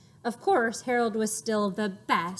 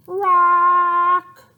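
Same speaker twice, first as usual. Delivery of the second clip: much louder, higher squawk; biggest loudest sound; 7 semitones higher, 13 dB louder than usual. Acted. Harold's first loud squawk, all together. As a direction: belt out a parrot cry. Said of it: "loudest Harold sound".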